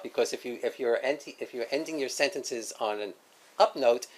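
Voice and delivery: ordinary talk, nothing marked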